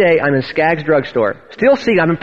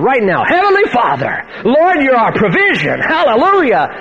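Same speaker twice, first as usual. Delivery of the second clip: mock full-voiced preacher praying, pitched up; imitated unrestrained prayer; 6 semitones higher than usual. Tom praying over Ron in the drugstore, imitated unrestrained prayer. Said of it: "He didn't know how to pray in a soft tone".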